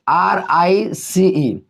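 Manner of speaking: very slow आर आई सी ई, one letter at a time; spelled out slowly. Shown as a demonstration. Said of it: "जैसे कि rice". (धीरे धीरे spelling)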